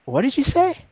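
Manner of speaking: high-pitched disbelieving question; incredulous challenge. Retold or imitated. Storyteller questioning the man who threatened him, incredulous. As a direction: pitch it right up, rising at the end. Acted challenge to stranger on bus.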